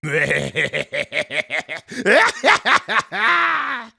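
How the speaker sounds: loud cackle climbing to a high shriek; long, rising mad laugh; maniacal villain cackle. Acted. Goblin announcing himself to enemies, maniacal cackle.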